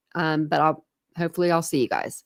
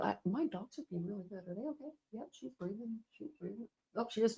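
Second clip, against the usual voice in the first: much softer, higher, away from the listeners; very quiet aside; 4 semitones higher, 18 dB quieter than usual. Real, not acted. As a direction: check on the dogs in a hushed voice. Turns away to check on dogs.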